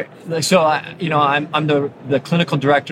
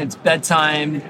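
ordinary delivery

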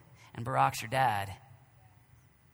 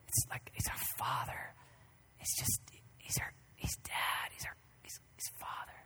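soft, breathless whisper; hushed wonder at the word father; awed whisper; 8 dB quieter than usual. Real, not acted. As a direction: whisper it in awe. Hushed awe over Abba.

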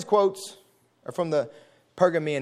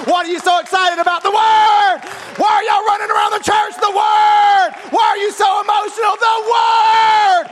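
shouting sermon, high and loud; rousing the church to the word; preaching at full force; 12 semitones higher, 5 dB louder than usual. Acted.

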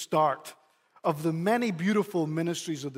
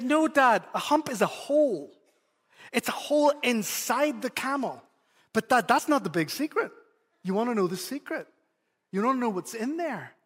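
put-on higher, insistent little boy; eager child voice; 5 semitones higher than usual. Son telling dad what's inside the hump, higher eager voice. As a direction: play the son bursting with his secret. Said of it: "He said".

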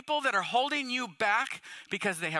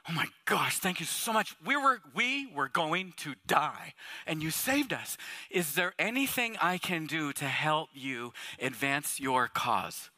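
lower, slow, humble thanks; grateful gushing; 8 semitones lower, slower than usual. Retold or imitated. Saved passenger thanks the captain, slow and humble. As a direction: pour out thanks to the rescuer, lowered. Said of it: "We would be".

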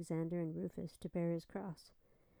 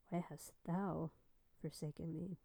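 slow, fading; 5 dB quieter than usual. A narrator's reading. Jesus' words from the cross.

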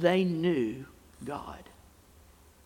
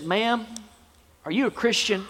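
lightly voiced little boy, higher; little boy's question; 8 semitones higher, 4 dB louder than usual. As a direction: ask it like an earnest young boy. Joke voicing a little boy's question.

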